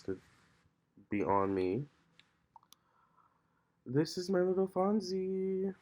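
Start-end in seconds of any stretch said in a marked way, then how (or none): 3.8-5.7 high, slow coo to a pet. Real, not acted. Owner cooing to her cat Fonzie on camera, high baby talk. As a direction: melt over the cat.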